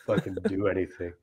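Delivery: ordinary delivery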